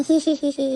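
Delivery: mocking "hee-hee" cackle; gloating giggle. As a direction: giggle gleefully at a rival's misery. Gloats over rival family's trouble.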